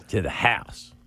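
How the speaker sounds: drawled "To the house." with a Southern twang. Retold or imitated. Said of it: "He put the Southern twang on it".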